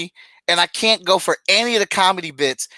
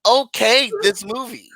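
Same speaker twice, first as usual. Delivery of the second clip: mock appalled high voice; incredulous quote; 6 semitones higher than usual. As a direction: ask it like you can't believe it. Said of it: "I'm like".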